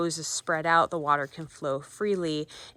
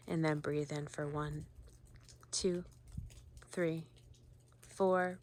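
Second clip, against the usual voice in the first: counted breathing guidance; 6 dB quieter than usual. Acted. Guide counting inhale and exhale for viewers, soft meditation counting.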